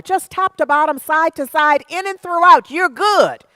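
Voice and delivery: loud, driving sermon delivery with push on each phrase; preaching at full force. Acted. Minister urging listeners to grow, preaching loud and fervent. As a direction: preach it to the congregation with rising fervor.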